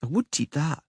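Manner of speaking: put-on high girlish voice, disdainful; a picky child refusing food; grunting girl. Princess refusing the fatback, disdainful. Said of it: "she grunted".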